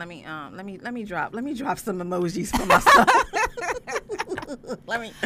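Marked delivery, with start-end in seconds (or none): 1.3-5.1 laughing at herself; loud giggling through words. Real, not acted. Laughing about own cheating.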